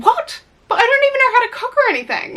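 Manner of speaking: high, fast squeaky disbelief; shocked girl. Acted. Reader voices Patricia's shocked high-pitched protest. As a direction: squeak it out like a panicked naive girl.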